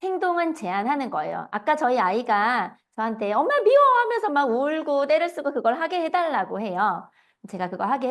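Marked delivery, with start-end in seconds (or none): 3.3-3.8 put-on crying child's cry.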